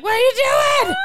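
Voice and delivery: high, loud panicked yell; re-enacted frantic disbelief; retold panic. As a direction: shout it in mock alarm. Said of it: "Pure panic".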